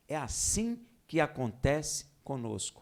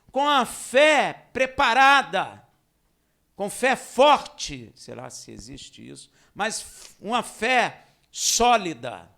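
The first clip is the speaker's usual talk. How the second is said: slow, loud, higher preacher's call; rising revival-style sermon; fiery preaching; 5 semitones higher, 11 dB louder, slower than usual. Acted. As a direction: hammer each phrase slowly from the pulpit. Preaching at full force.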